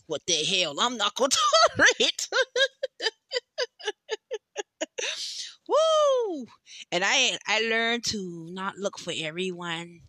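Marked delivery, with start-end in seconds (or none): 3.3-5.6 high laugh that breaks into crying; laughing through tears. Real, not acted. 5.7-6.5 high, loud release whoop. Real, not acted. Releasing emotion after laugh.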